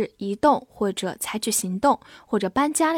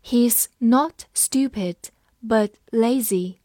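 He's not stupid, but lazy said slowly; slow reading; slower than usual. Shown as a demonstration. Teacher slowly reading the sentence aloud. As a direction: read it slowly, word by word. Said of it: "我们慢读一遍".

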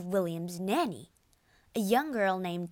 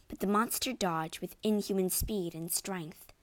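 ordinary delivery, nothing marked

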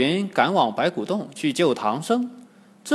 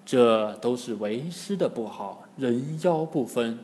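ashamed; 4 semitones lower, 6 dB quieter than usual. A narrator's reading. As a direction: admit the fault quietly in shame. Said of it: "唐僧很羞愧，". (羞愧地低声认错)

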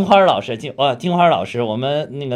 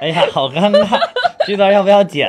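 laughing, louder and higher, a bit flustered; embarrassed laugh; 7 semitones higher, 6 dB louder than usual. Real, not acted.

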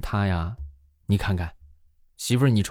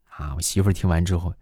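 normal talking voice

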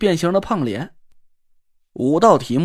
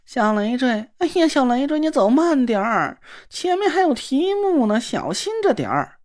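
simpering sissy man; 6 semitones higher than usual. Acted. Na Ruolan fawning after the girl who ignores him, simpering. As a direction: coo at her like a fawning dandy. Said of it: "纳若兰贱嗖嗖地跟在我身后， 兰花指".

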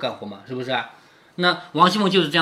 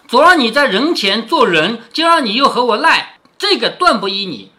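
lightly acted accusation, raised and louder; mock reproach; 5 semitones higher, 6 dB louder than usual. Sister-in-law disputing the missing share, mildly acted reproach. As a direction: read it as a playful telling-off.

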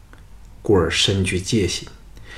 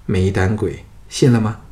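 ordinary delivery

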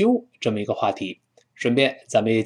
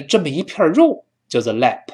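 ordinary talk, nothing marked

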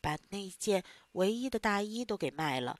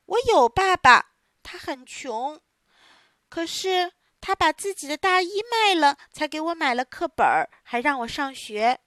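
earnest plea; 6 semitones higher, 6 dB louder than usual. Acted. Pinocchio confesses about father.

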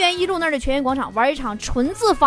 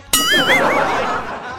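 hearty laugh. Real, not acted. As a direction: burst of real laughter. Host laughs at own joke. (开怀大笑)

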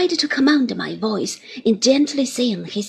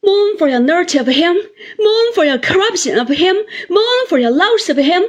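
high, loud, ringing repeated command; bitter climactic cry; impassioned denunciation; 7 semitones higher, 6 dB louder than usual. A narrator's reading. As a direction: hammer out each reproach, slowing on the last. Climax of Rosa's tirade.

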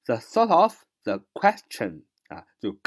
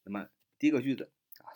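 ordinary delivery

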